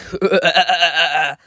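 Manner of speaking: quick staccato chuckle, mimicking the prop; put-on stuttering "huh-huh-huh" laugh; imitated villain laugh. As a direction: mimic a creepy laugh in short, even bursts. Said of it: "that little laugh at the beginning".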